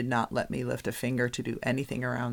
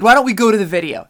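ref with attitude; 8 semitones higher, 14 dB louder, much faster than usual. Retold or imitated. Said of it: "He, he said".

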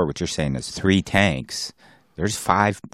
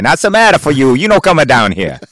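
higher, louder hammed-up Italian accent; mock Italian accent; 9 semitones higher, 7 dB louder than usual. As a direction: play the dive master as a joking Italian. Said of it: "I'm an Italian".

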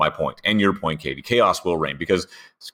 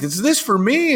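much higher mock-delighted question; Ryan; 3 dB louder than usual. Retelling a gift exchange, imitating high surprise. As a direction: act out a high, put-on surprise. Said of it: "Ryan's like".

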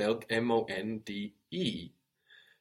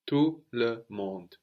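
said slowly with silent t; slow model; slower than usual. Shown as a demonstration. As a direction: spell out each word slowly.

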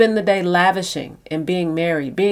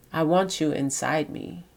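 much softer, rising sultry line; soft and velvety; 3 semitones lower, 8 dB quieter than usual. Retold or imitated. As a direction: murmur it softly like a lover in bed. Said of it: "Her voice all full and velvet".

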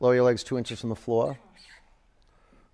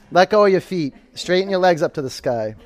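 ordinary talk, nothing marked

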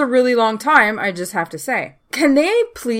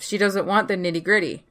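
ordinary talk, nothing marked

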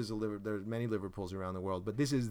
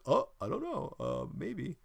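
coy evasive; 6 semitones higher, slower than usual. Retold or imitated. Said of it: "I don't wanna be like".

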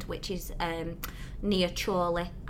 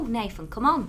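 ordinary delivery

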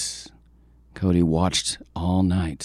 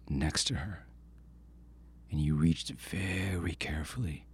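whispered narration; 10 dB quieter than usual. A narrator's reading. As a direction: breathe it like sneaking. Coyote sneaks to the fire.

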